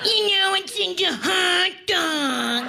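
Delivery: high teasing buddy voice; annoying friend. Retold or imitated. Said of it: "You got that friend that's like".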